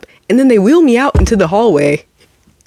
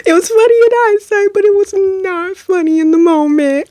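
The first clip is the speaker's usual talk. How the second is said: laughing high voice; 11 semitones higher than usual. Real, not acted.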